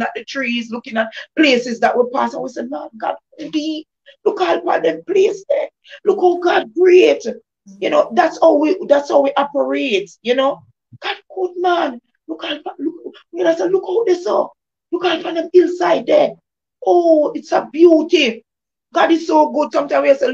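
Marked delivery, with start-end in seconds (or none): 2.6-7.2 raised, wondering praise of God's handiwork; excited awe. Retold or imitated. Talking with friends in the car, marvelling at creation, pitched up. Awed friends admiring the scenery. 11.0-19.7 raised, marvelling voice swelling into a shout; excited praise. Retold or imitated. Admiring the hillsides from the vehicle with friends, raised excited praise.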